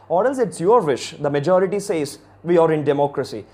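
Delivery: ordinary talk, nothing marked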